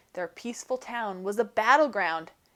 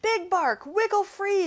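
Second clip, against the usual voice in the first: shouting; 10 semitones higher than usual. A narrator's reading. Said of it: "the prairie dog shouted".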